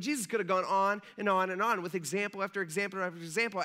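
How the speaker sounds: ordinary talk, nothing marked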